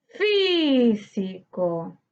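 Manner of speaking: "Físico" with a long, held FÍ, then si-co; stressed syllable stretched. Shown as a demonstration. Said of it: "debemos buscar la sílaba tónica". ("Físico" alargando mucho la sílaba tónica FÍ)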